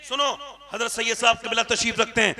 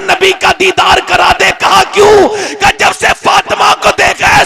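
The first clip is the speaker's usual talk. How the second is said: shouted sermon climax, much higher and louder; preaching at full force; 9 semitones higher, 10 dB louder than usual. Acted. Preacher to the gathering, shouting the couple's quarrel. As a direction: roar the wife's plea to the rafters.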